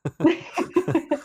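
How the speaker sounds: squealing high giggle; high-pitched laugh. Real, not acted.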